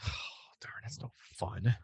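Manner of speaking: whispered letdown; disappointed whisper. Real, not acted. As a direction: sigh it out under your breath. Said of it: "disappointed whisper".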